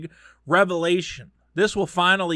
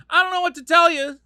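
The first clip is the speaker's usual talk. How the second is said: dismissive shrug voice; 12 semitones higher, 5 dB louder than usual. Real, not acted. Dismissive aside.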